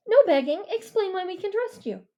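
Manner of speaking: put-on stern girl's challenge; commanding girl. A narrator's reading. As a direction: Mia taking charge. Mia voiced by narrator.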